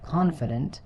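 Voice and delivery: "confident" stressed on the opening "con"; careful "confident", weight up front on "con"; first-syllable stress. Shown as a demonstration. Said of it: "stress is on the first syllable".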